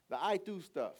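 loud, high preacher's build-up; preaching. Acted. Preacher pressing the congregation, loud full-force preaching.

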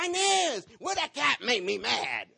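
high, loud, laughing yell; retold yell at wife. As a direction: shout her name through laughter, slowed down. Cat landed on his face.

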